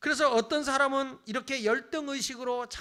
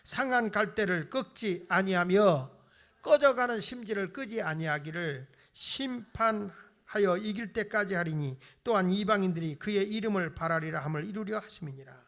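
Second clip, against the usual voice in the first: scripture reading; 3 semitones lower, 4 dB louder than usual. Shown as a demonstration. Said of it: "시작". (천천히 엄숙하게 성경 봉독)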